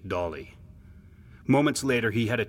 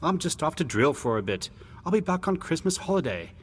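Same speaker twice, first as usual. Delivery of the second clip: higher-voiced, reassuring brother; dismissive big brother; 8 semitones higher than usual. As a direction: brush off the boy's worry, pitched up. Brother brushes off the worry.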